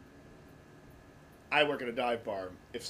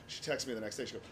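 usual speaking voice

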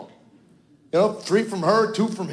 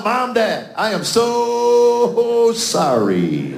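slow, drawn-out pleading apology; milk the sorry like a begging kid; mock repentant son; 3 semitones higher, much slower than usual. Son's letter home, hammed-up remorse.

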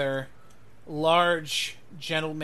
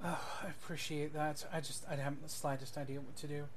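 much softer, sinking to a defeated murmur; helpless and quiet; 13 dB quieter, faster than usual. Acted. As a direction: admit helplessness under your breath. Helpless noble answers offer of help.

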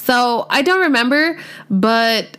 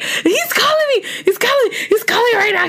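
very high squealed panic; frantic excitement; 14 semitones higher than usual. Retold or imitated. Telling a friend in the car that he's calling, frantic high squeal. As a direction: squeal it out in a reenacted freak-out. Retold panic at his call.

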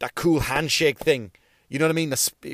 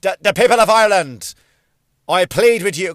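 louder pompous speech; mock Conor McGregor; 3 semitones higher, 7 dB louder than usual. Mocking Conor McGregor's grand plea to the Irish people. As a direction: ham up a grand plea to Ireland. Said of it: "Talking like he's fucking".

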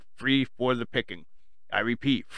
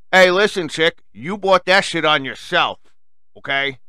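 loud, higher angry call-out; angry rant; 4 semitones higher, 8 dB louder than usual. Real, not acted. Ranting at the mother who cut in line, angry and loud. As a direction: tell her off hard.

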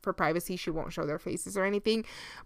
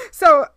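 a high laugh ending in a louder "So,"; giggle, then push on louder; 8 semitones higher, 18 dB louder than usual. Real, not acted. Laughing about the elote, then picking up again. Laughs at her own food talk.

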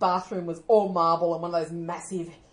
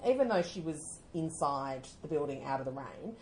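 ordinary talk

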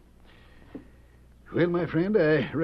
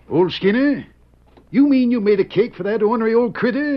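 scornful outrage; 7 semitones higher, 6 dB louder than usual. Acted. Sheriff scornful of Skinner.

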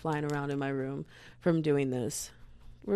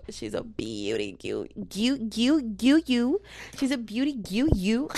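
silly stutter; 5 semitones higher, 4 dB louder, slower than usual. Pretend. Stuttering joke, getting louder.

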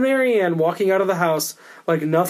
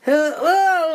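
high squeaky hello; 10 semitones higher than usual. Retold or imitated. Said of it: "and he's like".

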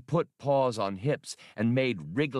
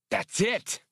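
sudden triumph; 12 semitones higher than usual. Acted. Han slamming the console as the plan clicks, triumphant. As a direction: shout it as the idea hits. Han's fist hits console.

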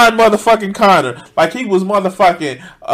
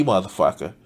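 muttered insult; 12 semitones lower, 10 dB quieter than usual. Real, not acted. Angry aside to guest.

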